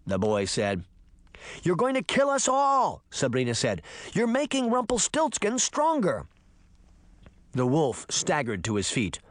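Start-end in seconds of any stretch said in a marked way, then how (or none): 1.6-3.0 high-pitched alarmed scolding; urgent girl. A narrator's reading. Sabrina scolding reckless Puck, urgent. 4.1-6.3 high-pitched alarmed scolding; urgent girl. A narrator's reading. Sabrina scolding reckless Puck, urgent.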